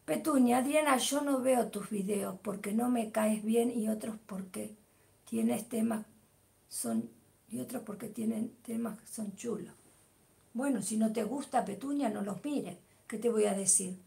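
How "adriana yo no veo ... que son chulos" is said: whispered half-reading of a comment; hushed careless read-through; mumbled misreading. Real, not acted. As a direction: skim it under your breath, getting it wrong. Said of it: "Ah, que lo lea bien".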